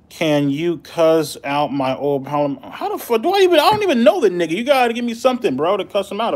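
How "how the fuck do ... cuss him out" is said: high, loud disbelieving protest; surprised. Real, not acted. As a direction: squeal in shocked disbelief. Caught off guard by request.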